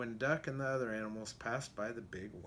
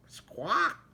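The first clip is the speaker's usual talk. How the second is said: loud, high chicken squawk; playful squawk; 6 semitones higher, 6 dB louder than usual. Acted. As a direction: squawk like a hen. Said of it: "said Chicken".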